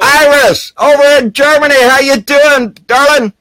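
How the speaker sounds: high, loud hearty greeting; cheerful call-out. Real, not acted. Greeting a viewer in the chat, a loud cheerful call-out. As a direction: sing out hello across the room.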